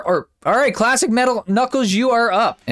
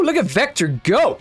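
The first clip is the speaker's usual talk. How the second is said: normal talking voice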